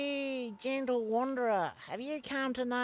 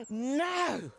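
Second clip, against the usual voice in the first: gasp; 4 semitones higher than usual. Acted. Seer gasps at vision.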